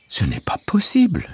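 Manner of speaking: high, delighted disbelief. A narrator's reading. Mother amazed at the short walk, high and delighted. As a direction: marvel at the shortcut. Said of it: "dit ma mère ravie".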